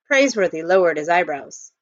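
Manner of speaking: ordinary delivery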